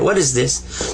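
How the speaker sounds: normal talking voice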